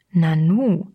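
a short, surprised exclamation; taken aback for a moment; astonished. A narrator's reading. Stranger surprised by the narrator's outburst, mildly astonished. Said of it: "staunte er".

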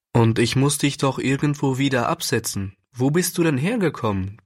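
usual speaking voice

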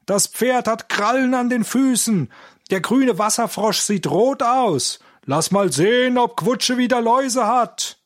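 high-pitched mocking yells; shouted schoolboy taunts. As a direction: call out jeers like a rowdy schoolboy. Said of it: "schrien sie durcheinander".

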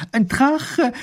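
high, loud call across the room; calling from afar. A narrator's reading. Neighbour calls to her husband from a distance, loud. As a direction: shout it before she reaches him. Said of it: "ruft sie ihm schon von Weitem zu".

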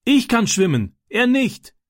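put-on high-pitched boy's shouted reply; calling back. A narrator's reading. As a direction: call the answer back over the water. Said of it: "rief Francis zurück".

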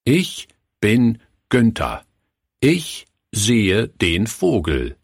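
slow, pausing before each word; child reading proudly with pauses; pause before every word. A narrator's reading. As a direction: read it word by word for effect. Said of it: "mit einer wirkungsvollen Pause vor jedem Wort".

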